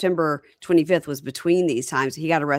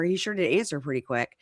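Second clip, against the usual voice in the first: ordinary talk, nothing marked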